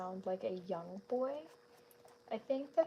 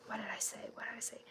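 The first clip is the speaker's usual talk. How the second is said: usual speaking voice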